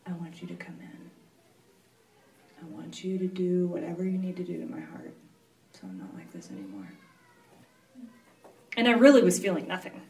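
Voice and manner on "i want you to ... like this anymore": soft, quick, drained plea; hushed and quick, tired surrender; quiet prayer. Retold or imitated. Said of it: "I'm like".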